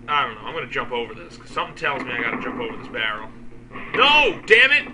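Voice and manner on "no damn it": loud, high frustrated yell; furious at dying in the game; angry shout. Real, not acted. Dies in game.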